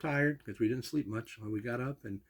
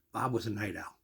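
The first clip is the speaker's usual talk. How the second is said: ordinary delivery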